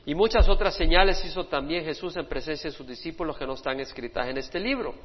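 usual speaking voice

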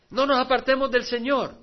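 called out loud to the congregation; rousing exhortation. Acted. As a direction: lift it as a rallying cry. Exhortación repetida, luego suave. (exhortación en voz alta)

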